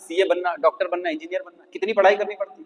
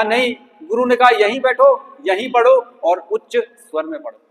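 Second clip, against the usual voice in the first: higher, louder, firmly insisting; insistent reply; 6 semitones higher, 6 dB louder than usual. Retold or imitated. Brahmin defending his guru's order, louder and higher. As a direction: refuse and stand by the guru's word.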